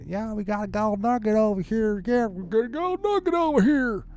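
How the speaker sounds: high-pitched mock American gold digger; American accent. American gold digger announcing a find, high mock American accent. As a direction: whoop it up as a hammed-up American. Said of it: "the American ones".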